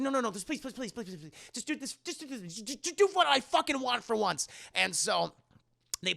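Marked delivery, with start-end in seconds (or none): 0.0-4.5 high, stumbling acted plea; frantic pleading. Wife begging the family, frantic pleading.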